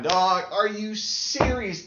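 ordinary delivery

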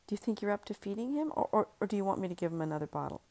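quick, nervous and hesitant; hurried. Acted. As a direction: rush it anxiously. Husband nervously offers help.

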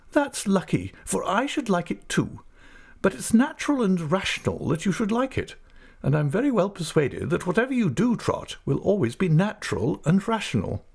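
stern aunt. Acted. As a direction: play the decisive old aunt. Aunt speaks, voiced high.

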